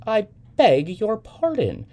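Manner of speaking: high, loud, slow, falling rebuff; indignant. A narrator's reading. Woman rebuffing the young man in the elevator, indignant. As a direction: draw it out in offended dignity.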